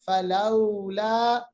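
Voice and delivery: फलौला slow, au drawn soft; soft drawn vowel. Shown as a demonstration. Teacher models फलौला slowly. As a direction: stretch लौ in फलौला gently. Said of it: "लोला नहीं".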